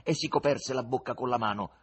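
ordinary delivery, nothing marked

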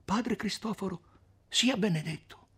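high, lightly voiced women greeting the friar together; women in chorus. A narrator's reading. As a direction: call out his name with relief and reverence. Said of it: "dicendo a una voce".

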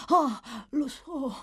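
a dying woman suddenly shouting out her memory; sudden cry. Acted. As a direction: jolt up and cry it out. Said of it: "gridò balzando su con violenza".

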